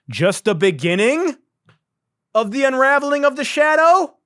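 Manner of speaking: incredulous alien parody; mock alien voice. Podcaster repeating the alien's words in disbelief, high mock voice. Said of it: "What the fuck did this alien say".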